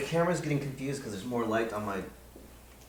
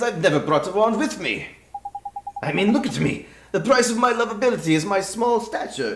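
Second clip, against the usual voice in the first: a fairy playing up being tiny and put-upon; louder, bouncy Irish-tinged fairy sidekick; cheeky fairy voice; 12 semitones higher, 9 dB louder than usual. Fairy confessing to the boy he has no wand, cheeky.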